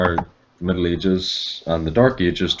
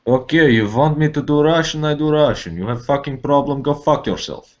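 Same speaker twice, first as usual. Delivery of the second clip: raised, louder mock Russian tough guy; hammed-up Russian accent; 6 semitones higher, 3 dB louder than usual. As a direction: growl it like a Russian thug. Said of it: "Can you do a Russian accent?".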